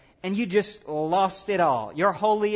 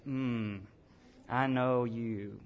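suspicious mutter; 7 semitones lower, 7 dB quieter, much slower than usual. Retold or imitated. Grudging thought on seeing backstabber.

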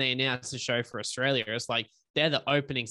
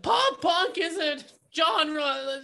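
high, slow whiny emo voice; 4 dB louder, much slower than usual. Retold or imitated. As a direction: whine it out like an emo singer. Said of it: "in that whiny voice".

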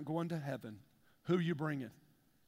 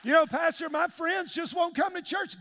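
whiny excuse; 9 semitones higher, 4 dB louder than usual. Retold or imitated. Congregant excusing himself to the pastor, high whining.

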